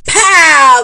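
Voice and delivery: loud, high "Pow!" like a rocket blasting off. A narrator's reading. Grandma makes the eagle's rocket blast-off noise. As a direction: shout the rocket launch.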